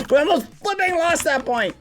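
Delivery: squeaky silly voice. Shown as a demonstration. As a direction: squeak it out like a cartoon. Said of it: "repeated in put-on voice".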